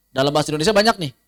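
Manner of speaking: normal talking voice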